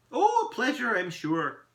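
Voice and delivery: high, loud "oh", easing off; effusive little elf courtesy; airy elf greeting. Acted. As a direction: toss off a grand greeting.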